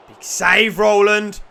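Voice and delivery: real excitement; loud praise. Real, not acted. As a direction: cheer the keeper's save. Praising the keeper loudly.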